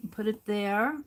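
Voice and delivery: talking with pins in mouth; mumbling around a mouthful; mouth full. Real, not acted. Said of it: "put that stuff in my mouth".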